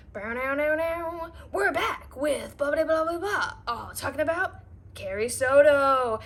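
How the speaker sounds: lightly imitated TV sportscaster; quick announcer patter. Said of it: "the audiobook would come on, like".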